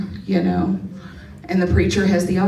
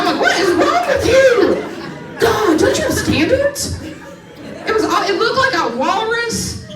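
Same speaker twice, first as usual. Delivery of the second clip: disgusted outburst; 11 semitones higher, slower than usual. Retold or imitated. Comic recalling her husband's partners, high disgusted rant. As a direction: pitch way up, appalled and laughing.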